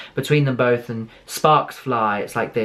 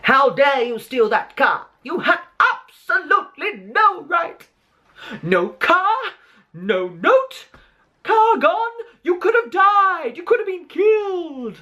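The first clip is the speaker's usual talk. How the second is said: Mrs Weasley howler; slower than usual. Retold or imitated. Mother scolding her son for stealing the car, shrieking. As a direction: shriek the telling-off like a howler letter. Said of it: "greeted very nicely by Missus Weasley".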